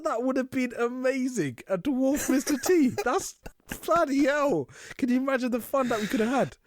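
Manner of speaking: high delighted disbelief; excited. Retold or imitated. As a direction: relish the missed joke. Retold excited reaction.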